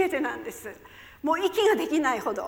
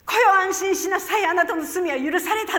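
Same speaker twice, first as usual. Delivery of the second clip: loud, high declaration of forgiveness; loud pronouncement; 5 semitones higher, 7 dB louder than usual. Retold or imitated. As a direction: proclaim it with full authority. Pronouncing forgiveness over the woman. (力強く宣言するように)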